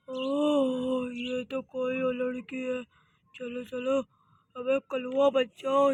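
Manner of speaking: low, hushed burglar muttering to himself; sneaky thief. Acted. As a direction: creep around low and quiet. Thief sneaking through house.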